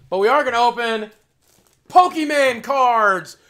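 high, slow, drawn-out announcement; excited hype. Real, not acted. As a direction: hype up the reveal. Hyping card opening.

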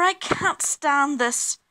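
high, loud, drawn-out complaint; exasperated bear. A narrator's reading. Mister Bear disturbed by the clock, loudly exasperated. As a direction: wail it slowly, fed up.

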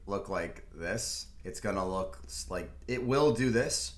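ordinary talk

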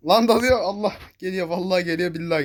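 loud, high alarm shout; panicked shout. Real, not acted. Gamer yells as an enemy charges him, panicked shout. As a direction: yell as it rushes you.

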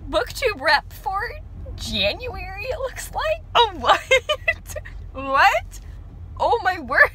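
squealing high and loud with shock; can't believe the news; overjoyed disbelief. Real, not acted. Vlogger discovering she's the new booktube rep, squealing with joy.

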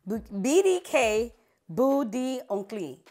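"B D K, Bout d'Onclis" very slow, syllable by syllable; retold careful breakdown of "Bout d'Onclis"; broken-down pronunciation. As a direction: spell it out slowly, piece by piece. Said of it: "I broke it down".

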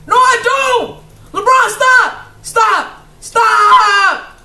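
high, loud desperate yells; pleading yells. Real, not acted. Player begging LeBron to stop, terrified. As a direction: beg him to stop in panic.

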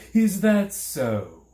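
loud, high, drawn-out reply; dismissive question. A narrator's reading. The man brushes Milo off.

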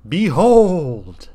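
loud, high proclamation; cry out. A narrator's reading. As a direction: announce a miracle with full force.

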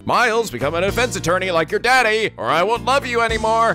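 high hammed-up dad voice; mock father voice. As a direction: play the scolding father, up high. Recap skit, father voiced.

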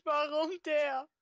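high, slow drawn-out dismay; dismayed wail. Real, not acted. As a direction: wail it in disbelief. Dismay at game event.

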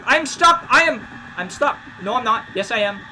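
high, loud frantic cries; panicked yelling. Real, not acted. Gamer stuck while chased, frantic yelling.

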